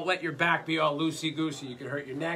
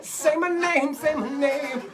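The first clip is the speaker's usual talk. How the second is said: squealing through laughter, pitched way up; laughing high mock demon; 12 semitones higher, 5 dB louder, slower than usual. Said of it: "all drunk old ladies sound like demons".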